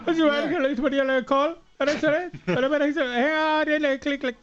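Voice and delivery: high, squeaky put-on caller voice; mock high-pitched person answering the phone; phone-call impersonation. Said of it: "Do you want me to impersonate".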